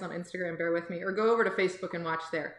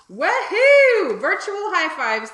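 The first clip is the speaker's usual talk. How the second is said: big high whoop of delight; cheer; 9 semitones higher, 11 dB louder, slower than usual. Real, not acted. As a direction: let out a loud happy woohoo. Cheering kids on during class.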